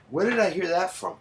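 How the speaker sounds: ordinary talk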